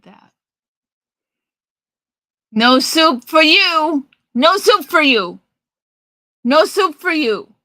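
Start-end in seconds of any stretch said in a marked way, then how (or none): 2.5-7.5 soup Nazi impression. Imitating the soup Nazi refusing a customer his soup. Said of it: "I do know about the soup Nazi".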